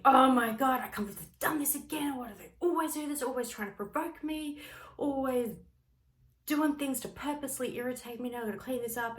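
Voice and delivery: rapid, high, annoyed venting; exasperated rant. Pretend. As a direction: spill out the irritation quickly. Parent's angry inner rant.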